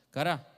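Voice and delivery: "Cará" said slowly, stress on the final A; "Cará" with the final A stressed, not "cara"; Hebrew word cará. Shown as a demonstration. Preacher teaching Hebrew word, "Cará" with final A stressed. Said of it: "con un acento en la A al final".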